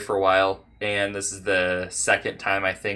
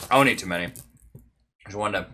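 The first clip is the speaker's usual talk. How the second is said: normal talking voice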